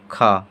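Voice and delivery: "खा" with a rough throaty kh, low and falling; letter sound demo. Shown as a demonstration. Teacher demonstrates "खा" as a throaty kh, low. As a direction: show the guttural sound in "खा". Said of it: "इसका उच्चारण हो जाएगा".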